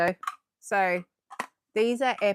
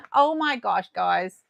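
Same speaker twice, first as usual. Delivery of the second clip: ordinary talk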